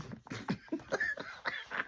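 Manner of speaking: high, breathless trailing giggle; wheezy laugh. Real, not acted. Woman apologizing for her silly mistake, wheezy laughter. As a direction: laugh until the voice gives out.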